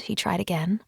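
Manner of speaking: ordinary delivery, nothing marked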